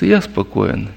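ordinary delivery

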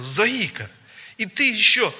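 imitated abusive outburst; scolding. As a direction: hurl a contemptuous insult. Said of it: "как она давай его".

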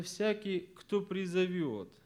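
«кто призовёт» drawn out slowly; slow emphasis on «кто призовёт»; stress on КТО ПРИЗОВЁТ. Shown as a demonstration. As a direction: stretch «кто призовёт» as the key phrase. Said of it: "вот на что я сделал ударение".

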